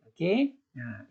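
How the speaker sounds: usual speaking voice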